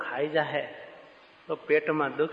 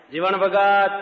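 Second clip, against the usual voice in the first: loud, higher call of a name; 4 semitones higher, 6 dB louder than usual. Retold or imitated. Maharaj calls Jivan Bhagat over the crowd, loud call. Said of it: "મહારાજે બૂમ પાડી".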